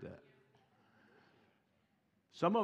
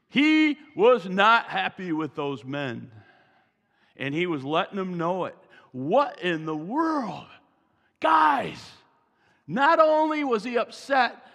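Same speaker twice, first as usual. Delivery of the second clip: louder, high, slowed emphatic sermon; fiery preaching; 3 semitones higher, 9 dB louder, slower than usual. Acted. Pastor stirring up his church about Jesus' anger, full-force preaching. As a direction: hammer the anger home.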